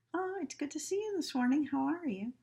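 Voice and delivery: chirpy greeting that ignores the bad news; fake cheerful. Shown as a demonstration. Nurse greeting a sick patient, high and fast. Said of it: "instead of".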